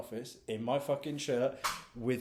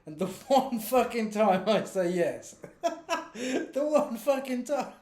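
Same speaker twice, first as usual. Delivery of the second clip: slow, laughing through it; 10 semitones higher, 5 dB louder, slower than usual. Real, not acted. Podcaster laughing at being stood up, slowly. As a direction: crack up at the irony.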